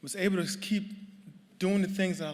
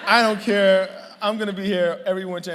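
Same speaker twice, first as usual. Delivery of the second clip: laughing boast; 6 semitones higher, 12 dB louder than usual. Retold or imitated. Excited recruit vowing to stay.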